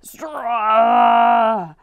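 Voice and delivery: long, strangled wordless yell; a shout that comes out as a garbled wail; strangled cry. A narrator's reading. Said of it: "all that came out was".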